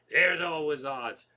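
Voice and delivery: put-on loud, slow drunken heckle; stretched-out boozy holler; drunken guy. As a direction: imitate a drunk butting in loudly. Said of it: "another drunken guy who had nothing to do".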